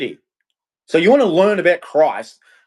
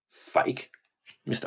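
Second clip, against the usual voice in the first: whispered; 12 dB quieter than usual. Real, not acted. Repeating insult.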